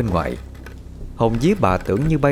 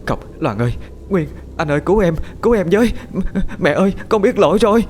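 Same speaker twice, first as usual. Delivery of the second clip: rising into a panicked, much higher, louder scream for help; begging for rescue, building to terror; terrified pleading; 10 semitones higher, 3 dB louder, faster than usual. Acted. Ms Hà wakes screaming to her family for help in terror.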